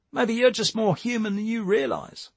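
high-pitched character voice; second character in dialogue. Acted. The other character replying to Jake, high-pitched voice.

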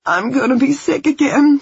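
loud, high nauseous moan. A narrator's reading. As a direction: groan it out, about to vomit. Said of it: "she moaned".

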